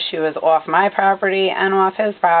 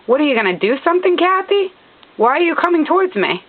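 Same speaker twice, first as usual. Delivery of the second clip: higher-pitched challenging questions; retold confrontation; 7 semitones higher than usual. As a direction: retell it as a pointed challenge. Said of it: "So I said to her".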